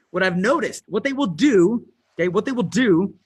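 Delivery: usual speaking voice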